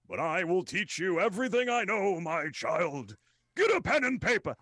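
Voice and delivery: high, loud wise-master voice; hammed-up sensei, booming and lofty; grand mentor voice. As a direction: proclaim it like an old sage to a pupil. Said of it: "I'll be like".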